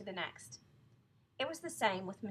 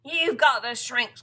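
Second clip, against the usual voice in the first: loud, slow accusation; crying out; 3 semitones higher, 17 dB louder, slower than usual. A narrator's reading. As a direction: pronounce it like a dire verdict. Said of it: "cried Mister".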